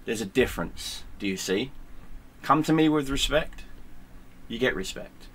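ordinary delivery

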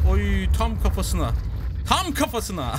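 loud, high cheer at a hit; thrilled shout breaking into a laugh; excited gloating. Real, not acted. Celebrates hit on the head.

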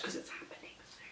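fast breathy whisper; hurried whisper. Real, not acted. Camera battery dying, whispering fast. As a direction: rush it out in a whisper.

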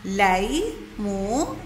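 ليمون broken into لي، مو، ن with pauses; syllable by syllable. Shown as a demonstration. Teacher showing how ليمون is built, syllable by syllable. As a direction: stretch each part of ليمون separately. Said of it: "فبتطلع عنا الكلمة".

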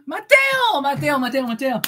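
calling Mateo again and again. Real, not acted. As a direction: call his name across the room. Calling out a name.

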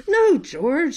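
a mild high reproach of no George; a playful tut-tut of the mother; scolding mother. Acted. Mother dog correcting George, mild scolding.

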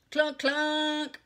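high, loud machine clunks; yelling. A narrator's reading. Child jumps from chair, yelling. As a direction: yell it like a pile driver. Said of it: "yelled Ramona".

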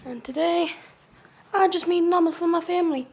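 usual speaking voice

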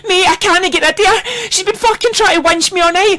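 ordinary delivery

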